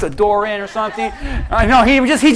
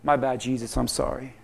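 sheepish; 11 semitones lower, 11 dB quieter than usual. Acted. Thomas sheepishly apologizing.

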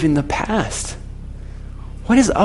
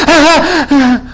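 high mock laugh dying away; imitated laugh; 8 semitones higher, 4 dB louder, slower than usual. Passenger realises what was said, laughter fading out. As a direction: laugh in bursts, then let it fade. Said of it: "she was laughing".

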